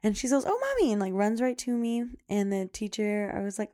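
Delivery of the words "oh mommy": put-on very high, delighted little girl's squeal. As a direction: squeal it like a child greeting mom. Daughter greeting her mom.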